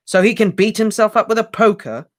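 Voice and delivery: high, loud scornful snort of a question; snorting retort. A narrator's reading. Harry snorts back at Hermione. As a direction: scoff back at her. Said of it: "snorted Harry".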